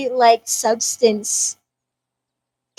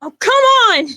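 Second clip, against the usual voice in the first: frustrated shout; 8 semitones higher, 6 dB louder than usual. Acted. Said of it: "And he goes".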